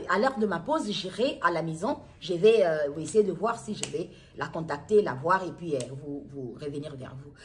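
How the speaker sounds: normal talking voice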